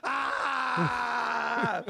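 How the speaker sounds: high, hammed-up overreacting yell; a TikToker's exaggerated cry of despair; mock scream. TikToker screaming over the TikTok ban, mock anguished yell. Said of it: "TikTokers who are like".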